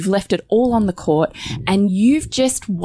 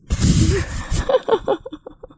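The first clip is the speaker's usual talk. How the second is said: high incredulous laugh; 12 semitones higher than usual. Real, not acted. Wife laughing in disbelief at husband's excuse.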